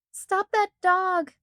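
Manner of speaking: a high, drawn-out call to strangers to stop the dog. A narrator's reading. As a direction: call it out over the crowd. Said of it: "she called".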